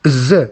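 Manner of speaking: Z said as a buzzed "zuh"; Z as an isolated zoo sound; consonant sound demo. Shown as a demonstration. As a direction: sound out Z, not the letter name. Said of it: "as in zoo".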